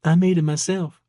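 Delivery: high-pitched Georgia waitress; playful question in a high Georgia accent. A narrator's reading. Waitress answering a curious customer, coy Georgia accent. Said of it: "an accent that was distinctly from Georgia".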